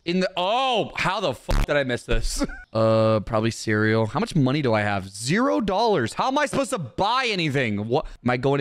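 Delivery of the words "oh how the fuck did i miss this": ordinary talk, nothing marked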